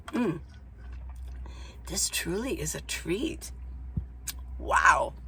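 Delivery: soft, slow, hushed delight; savoring. Real, not acted. Tasting her smoothie for viewers, soft and slow. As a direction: murmur it like the first sip is bliss.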